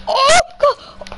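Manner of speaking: high panicked yelp; startled shout. Real, not acted. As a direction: yelp as the shots hit. Sudden rush under fire.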